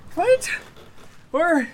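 high loud call. Acted. Searching for Clint, high loud call. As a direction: call out anxiously.

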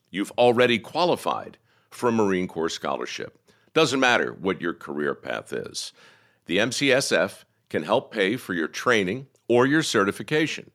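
upbeat sponsor read. Acted. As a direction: sell it like a radio ad. Reads a sponsor ad.

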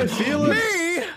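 mock rapture. Mock faith healing on call.

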